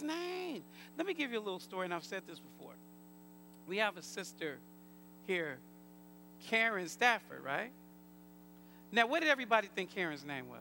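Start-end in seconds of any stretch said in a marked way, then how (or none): none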